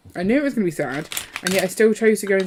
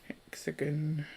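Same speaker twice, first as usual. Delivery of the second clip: whisper; 4 semitones lower, 14 dB quieter, slower than usual. Real, not acted. Trying to place the venue.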